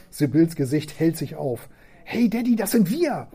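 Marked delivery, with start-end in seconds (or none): 2.1-3.3 high, delighted cry; excited child. Acted. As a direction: burst out with joy. Daughter thrilled at reply.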